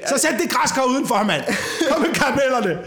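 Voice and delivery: high, put-on fed-up voice with a laugh; mock exasperation. Giving in to Halloween candy, high mock exasperation. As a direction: throw up your hands in mock defeat.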